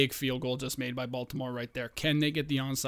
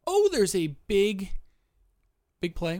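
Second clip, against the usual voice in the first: excited outburst; 6 semitones higher, 4 dB louder, slower than usual. Real, not acted. Reacting live to football game.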